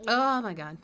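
loud fearful cry; frightened exclamation. Real, not acted. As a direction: blurt it out in fear. Scared by game.

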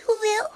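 sudden hiccup sound; a sharp hiccup mid-speech. Real, not acted. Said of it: "get rid of my, Will, hiccups".

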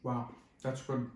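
chewing while talking; talking between bites; mouth full. Real, not acted. Seen 0: Holds sandwich at mouth, cheeks working between bites while talking.

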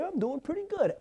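smug answer. Retold or imitated. Mocking self-satisfied answer.

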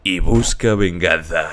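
low, drawn-out dramatic narrator; ominous narration. Pretend. Narrator announcing a father's revenge, low and ominous.

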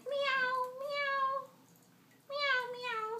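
high, squeaky kitten meows; cat meow. Acted. Parent reading Barney to child, high cat meowing. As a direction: mew like a little cat. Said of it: "says the cat".

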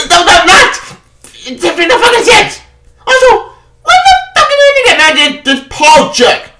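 very high strained yell near crying; shrieking fury. Real, not acted. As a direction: scream it in disbelief. Peak of angry rant.

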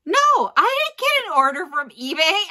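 sudden loud, high "What?" of alarm; startled protest, voice jumping up; shocked disbelief. Retold or imitated. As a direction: blurt it out in shock. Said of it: "I'm, like, freaking out".

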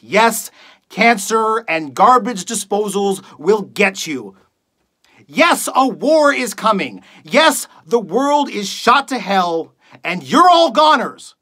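emphatic, acted doom-laden tirade; accusing rant. Radio host tells audience the world is doomed, accusing rant. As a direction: hammer each accusation at the audience. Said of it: "reading a monologue for you guys".

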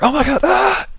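high, loud shriek of shock; startled shriek. Real, not acted. Gamer shrieking at a sudden scare on screen. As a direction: yelp as something jumps at you.

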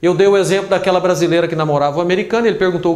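normal talking voice

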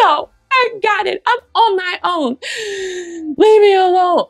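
put-on high, whiny child pushing away help; upset child. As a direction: cry it out like a hurt little kid. Child rejecting parent's help.

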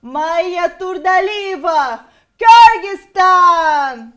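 English accent pageant announcement, slow and loud; English accent, shouted and drawn out. Shown as a demonstration. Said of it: "Давайте с английским акцентом".

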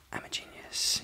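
a breathy, voiceless whisper to herself. Real, not acted. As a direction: hush it like a secret, no voice at all. Sudden aside after hair boast.

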